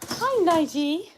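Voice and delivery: high cooing hello; pet greeting voice. Real, not acted.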